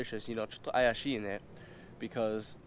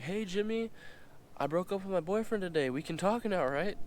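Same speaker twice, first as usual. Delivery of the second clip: put-on much higher, sweet girlish voice; girly voice; 9 semitones higher than usual. The girl messaging him after her breakup, high sweet voice. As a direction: play her as chirpy and high. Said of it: "And she's like".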